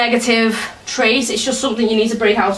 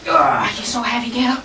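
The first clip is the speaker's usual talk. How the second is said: strained whisper; 3 dB quieter than usual. Real, not acted. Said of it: "I'm out of breath now".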